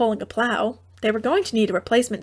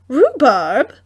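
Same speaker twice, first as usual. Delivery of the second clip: surprised question; 2 semitones higher, 6 dB louder than usual. A narrator's reading. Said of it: "said in surprise".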